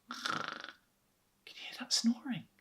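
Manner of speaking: high, soft whispered question. Acted. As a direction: whisper so he won't wake. Mr Brown whispers.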